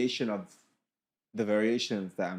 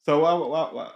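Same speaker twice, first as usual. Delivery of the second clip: exasperated loud; 4 semitones higher, 10 dB louder than usual. Real, not acted. Mock exasperation at guests.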